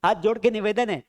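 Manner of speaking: usual speaking voice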